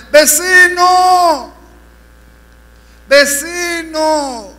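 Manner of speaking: long, high, drawn-out wailing shout; voice calling from hell. Acted. Damned neighbor calling to the evangelist from hell, anguished drawn-out shout. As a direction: cry out "neighbor" slowly at full voice. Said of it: "se oía una voz que le decía".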